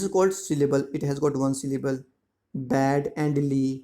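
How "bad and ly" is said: "Bad" and "ly" said as two slow, separate beats; split into syllables. Shown as a demonstration. Teacher counts syllables for pupils, "Bad" and "ly" slowly separated. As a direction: break it slowly into "Bad", then "ly". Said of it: "it has two syllable".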